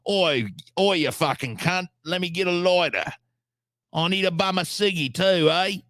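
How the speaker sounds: high, hammed-up Aussie lout; mock Australian accent. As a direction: play a broad Australian cadging off a mate. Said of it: "an Australian's down there like".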